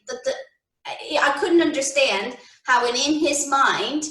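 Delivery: ordinary talk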